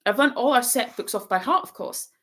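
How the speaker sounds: high, rapid bossy chatter; very fast. A narrator's reading. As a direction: rattle it all off in one breath. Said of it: "She said this all very fast".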